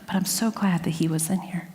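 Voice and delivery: real whispered aside to the room; hushed aside. Real, not acted. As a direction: drop to a whisper as a side remark. Aside about the kid in room.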